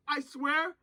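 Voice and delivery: normal talking voice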